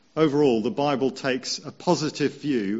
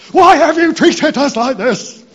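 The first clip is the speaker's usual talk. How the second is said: much higher, much louder shrieking demand; loud, high anxious mother scolding; exasperated shout; 15 dB louder than usual. Retold or imitated. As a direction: yell it like a frantic parent. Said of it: "probably a bit more like this".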